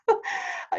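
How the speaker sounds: breathy laugh; short laugh at the joke. Real, not acted. Laughs at own example.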